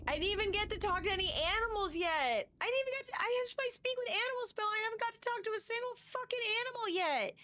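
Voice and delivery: high, frantic complaint; high-pitched despair. Acted. Player despairs about dying.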